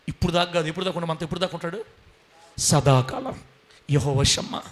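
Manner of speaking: ordinary talk, nothing marked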